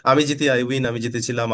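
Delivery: ordinary talk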